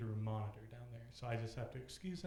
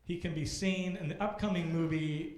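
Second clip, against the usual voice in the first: loud announcer build to the name; MC introduction; 8 dB louder than usual. Acted. Host welcoming the next comedian to the stage, loud announcer voice. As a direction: hype the next act, peak on the name.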